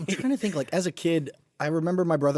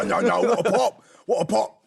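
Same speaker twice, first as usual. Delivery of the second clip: mock British; 6 semitones higher, 3 dB louder than usual. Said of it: "We talked like British people".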